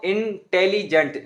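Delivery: Intelligent said slowly in three parts, in-tel-li-gent with breaks; syllable by syllable. Shown as a demonstration. Teacher showing how intelligent breaks apart, slow. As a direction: spell out intelligent in slow beats. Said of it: "हमने दो दफ़ा break दिया".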